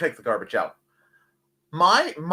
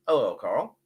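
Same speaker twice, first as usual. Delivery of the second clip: usual speaking voice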